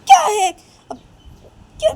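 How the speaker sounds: very high, loud outburst; hammed-up annoyed protest; exasperated daughter. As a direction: snap at mom in a high squeal.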